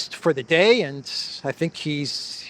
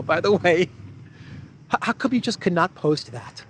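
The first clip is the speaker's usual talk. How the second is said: ordinary talk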